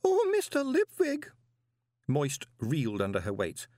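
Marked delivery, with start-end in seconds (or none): 0.0-1.3 very high, drawn-out distraught cry; yelled wail. A narrator's reading. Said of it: "she yelled".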